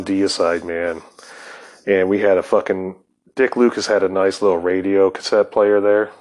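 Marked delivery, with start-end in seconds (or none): none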